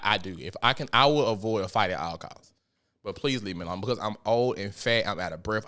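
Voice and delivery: normal talking voice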